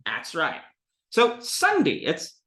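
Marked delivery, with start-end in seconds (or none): 0.1-0.6 "That's right" said as "'at's right", lower; Southern dropped th. Shown as a demonstration. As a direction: leave the th off "That's". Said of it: "It's not "That's right".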